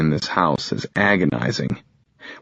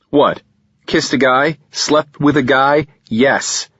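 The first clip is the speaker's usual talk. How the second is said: snapping back; 7 semitones higher, 5 dB louder than usual. Acted. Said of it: "I snap".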